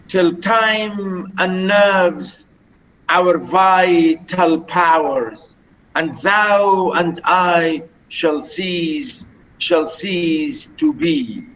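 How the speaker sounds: slow, drawn-out recitation of verse; measured verse reading. Shown as a demonstration. Teacher showing students the iambic metre, slow measured reading. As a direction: recite the line slowly, stretching stressed syllables.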